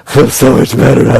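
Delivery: lightly voiced smoker sighing with pleasure; a hint of blissed-out smoker; mock relief. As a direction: play the quitter savoring a cigarette. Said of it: "stop smoking or stop doing drugs feels better when they".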